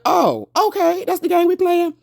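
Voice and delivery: very high, loud mocking taunt; sassy taunt. [name] taunts Carr before playing the footage, high loud sass. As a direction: throw the challenge back with relish. [name] firing back, dramatic.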